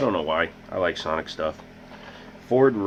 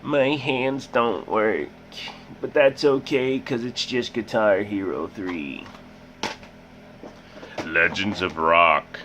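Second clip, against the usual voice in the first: silly put-on voice; slower than usual. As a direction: play a dopey character. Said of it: "Stop talking like that, turd".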